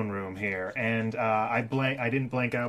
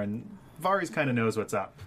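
usual speaking voice

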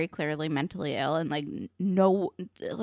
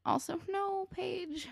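very high, crying whine; anguished plea; 12 semitones higher, 7 dB quieter, slower than usual. Retold or imitated. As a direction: wail it in despair. Speaker's own pleading reaction.

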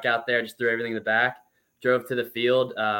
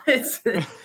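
high, bursting real laugh; sudden high giggle; burst of laughter; 12 semitones higher than usual. Real, not acted. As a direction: laugh out at the memory. Laughing at the trade news.

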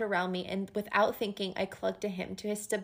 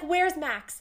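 higher, urgent imitation of a worried woman; anxious retold question; 7 semitones higher than usual. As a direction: ask it high, searching for him. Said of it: "nobody will answer her".

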